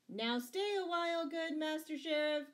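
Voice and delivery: high, loud mocking call; jeering. A narrator's reading. Scarlet to the fleeing sheriff, jeering. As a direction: taunt him as he runs off. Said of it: "jeered Scarlet".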